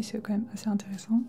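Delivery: normal talking voice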